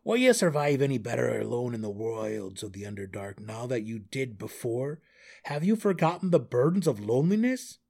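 lightly voiced exasperated gnome; challenging Belwar. A narrator's reading. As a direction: press him with loud questions.

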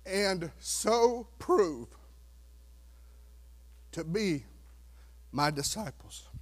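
very slow, softening verse; slow, fading scripture line; slow reading. Acted. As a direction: let each word hang.

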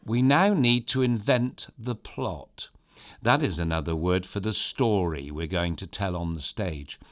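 usual speaking voice

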